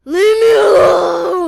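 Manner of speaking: long, high, screamed outburst; drawn-out furious yell; angry. Acted. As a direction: scream to be left alone. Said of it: "because I'm angry".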